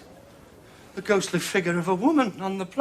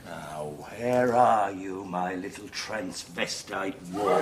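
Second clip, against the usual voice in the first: spooky low voice; 11 semitones lower, 4 dB quieter, slower than usual. Acted. Prank spooky voice in dark.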